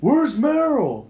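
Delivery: slow, high, loud holler; acted stranger's drawn-out shout; shouted demand. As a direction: drag out a shout through a door. Stranger shouts through the door.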